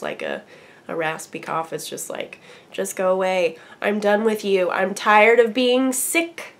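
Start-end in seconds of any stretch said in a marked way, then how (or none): none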